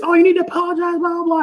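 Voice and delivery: high mock nagging voice; mock wife. Imitating his wife scolding him from the car, high mock voice. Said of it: "and I hear this".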